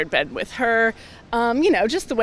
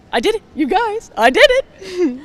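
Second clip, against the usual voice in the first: gleeful cheer; 10 semitones higher, 8 dB louder than usual. Retold or imitated. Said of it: "I remember being like".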